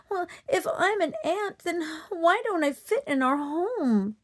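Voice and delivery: high, tearful little voice; a hint of a sobbing little horse. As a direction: plead through tears. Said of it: "Sobbingly, F-, Flutterby said".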